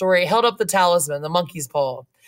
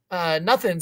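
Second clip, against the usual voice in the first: plain reading voice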